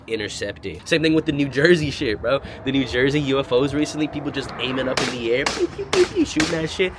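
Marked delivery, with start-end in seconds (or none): 5.4-6.2 high mock pew pew gunshots; playful gunshot sounds; gun noises. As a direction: fire off pretend shots up high. Imitating guns shooting at UFOs.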